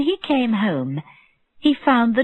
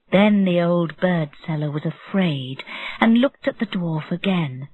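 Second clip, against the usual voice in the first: ordinary delivery, nothing marked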